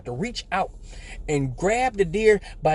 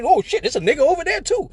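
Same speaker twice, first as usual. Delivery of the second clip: high, loud panicked deer voice; comic high squeal of a cornered animal; 10 semitones higher, 4 dB louder than usual. Acted. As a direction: voice the startled deer spotting him. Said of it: "So now the deer".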